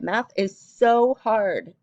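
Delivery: slow, loud whiny complaint; whining. Acted. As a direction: whine it out, drawn slowly. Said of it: "Kara whined".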